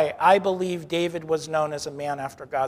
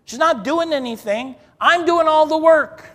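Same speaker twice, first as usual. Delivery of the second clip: higher, louder whiny complaint about her sister; mock Martha complaint; 9 semitones higher, 7 dB louder than usual. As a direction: play Martha griping to Jesus that Mary won't help. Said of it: "she's like".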